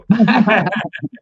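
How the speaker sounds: loud burst of laughter. Real, not acted. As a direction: laugh out loud, a quick run of ha-ha. Reacts to the recycled plastic remark.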